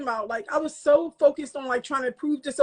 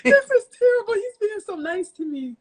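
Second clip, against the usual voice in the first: mock distress; 8 semitones higher than usual. Retold embarrassment at many retakes.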